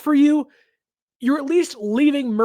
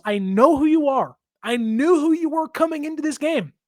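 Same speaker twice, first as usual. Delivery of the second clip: ordinary talk, nothing marked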